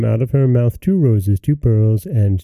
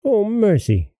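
horrified cry; 10 semitones higher than usual. A narrator's reading. Mother seeing vipers and toads, crying out. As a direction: exclaim in dismay, pitch raised. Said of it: "cried the mother".

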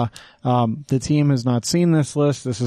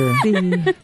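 excited shout; 6 semitones higher than usual. Real, not acted. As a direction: blurt it out with a laugh. Playful agreement after joke.

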